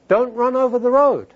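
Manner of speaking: mock-scolding parent, high-pitched and firm; stern parental warning. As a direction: warn a small child off danger, pitched high. Parent warning small son, retold.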